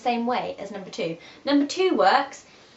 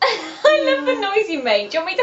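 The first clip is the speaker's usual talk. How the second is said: talking through laughter, higher and louder; laughing; 9 semitones higher, 6 dB louder than usual. Real, not acted. Friend cracks up at the noise.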